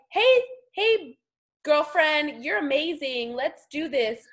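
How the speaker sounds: mock-gushy, high-pitched hey girlfriend; hammed-up peppy pitch, starting high and loud; gushing sales greeting. Imitating rival seller's gushing message.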